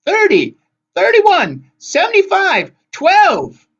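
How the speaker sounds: high, loud shrieked list; screamed numbers. A narrator's reading. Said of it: "the Gray sister screamed".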